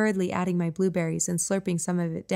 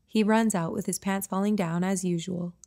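ordinary delivery

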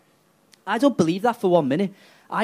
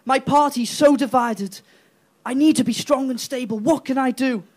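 higher, whiny pleading voice; mock Theresa May; 6 semitones higher, 3 dB louder than usual. Theresa May pleading to a magic money tree, higher and whiny. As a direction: plead to the tree like a lost prime minister. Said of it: "she went".

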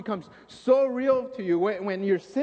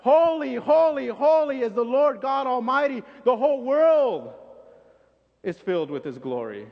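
even louder, higher holy holy holy; louder proclamation; 5 semitones higher, 7 dB louder than usual. Retold or imitated. Second angel topping the first with a louder, higher call. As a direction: outdo the other angel, full voice. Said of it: "the other angel just ups it up a little more".

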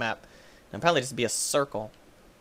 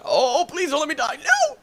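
high pleading whine; panicked plea; 13 semitones higher, 4 dB louder than usual. Pretend.

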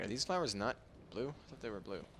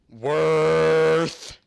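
loud drawn out; 5 semitones higher, 12 dB louder than usual. Real, not acted.

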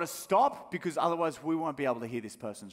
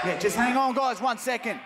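raised, higher voice to the crowd; calling out; 8 semitones higher than usual. Pretend. Prank caller to rioting cinema crowd, raised pleading shout. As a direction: call over the noise to hold them off.

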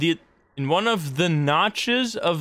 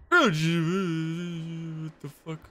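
drawn-out disbelief; 3 dB quieter, much slower than usual. Real, not acted. Baffled at shifting hallway.

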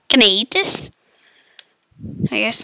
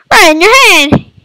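shouted taunt; 7 semitones higher, 6 dB louder than usual. Acted. Shouting taunt while shooting.

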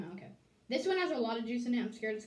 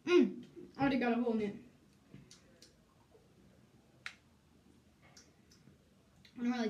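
talking while chewing candy; slightly muffled by chewing; mouth partly full; faster than usual. Real, not acted. Seen 0: hands over mouth chewing, then holds bitten candy while talking.

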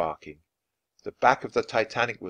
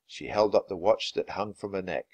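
plain reading voice

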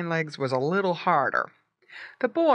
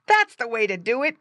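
put-on high, loud boy's praise; raised, higher-pitched cheer; crying out; 7 semitones higher, 5 dB louder, faster than usual. A narrator's reading. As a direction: call out encouragement as a boy. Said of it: "cried Bob".

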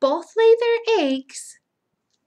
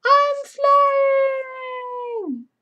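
high, loud, joyful cry; ladybug voice; 5 dB louder than usual. Acted. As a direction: squeal with delight like a little bug. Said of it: "Flip that up and it says".

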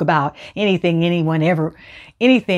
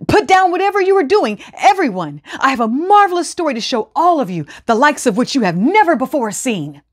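higher, eager showman's call; excited announcement; 6 semitones higher than usual. A narrator's reading. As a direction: drum up a crowd, bursting with news. Excited man summons villagers.

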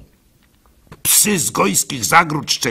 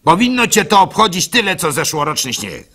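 dismissive advice; 5 semitones higher, 5 dB louder, faster than usual. Acted. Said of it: "odpowiedział".

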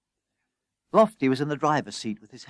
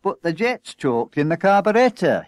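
loud, higher-pitched grumble; aggrieved objection, raised voice; complaining; 5 semitones higher, 6 dB louder than usual. A narrator's reading. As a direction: protest to the boss. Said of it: "he complained".

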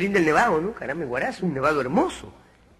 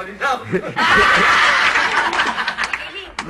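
carcajada aguda y fuerte; 5 semitones higher, 3 dB louder than usual. Real, not acted. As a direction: soltar una carcajada a todo volumen. (carcajada aguda y fuerte)

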